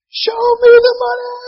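full scream. Retold or imitated. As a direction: scream it with everything you've got. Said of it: "he's just screaming".

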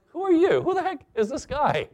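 high-pitched mock indignation; quite upset. Preacher imagining himself facing the intruder, upset high-pitched challenge. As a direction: act put out, voice shooting up. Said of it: "I would probably be quite upset".

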